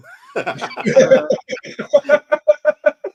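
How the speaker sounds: loud, high-pitched burst of laughter; real laughing fit. Real, not acted. Co-host cracking up at a joke about parenting, laughing hard.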